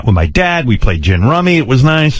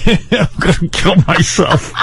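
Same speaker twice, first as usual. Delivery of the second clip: real laughter breaking into exasperation; laughing despair; 5 semitones higher, slower than usual. Real, not acted. Telling Robin about the parents, laughing in despair. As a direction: laugh through the words, stretched out and high. Cracking up over family woes.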